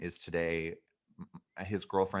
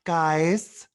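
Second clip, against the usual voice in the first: raised-pitch, emphatic mock-careful announcement; hushing-the-family relative; 7 semitones higher, 6 dB louder, much slower than usual. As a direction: alert the family as if a patient arrived. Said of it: "Like, I'm a cancer patient".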